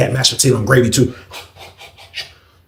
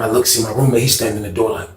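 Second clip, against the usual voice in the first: ordinary talk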